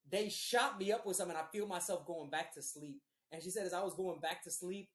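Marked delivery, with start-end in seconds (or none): none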